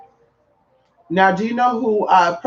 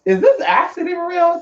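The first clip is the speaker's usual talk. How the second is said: high disbelieving quote; 11 semitones higher than usual. Retold or imitated. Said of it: "And I'm just like".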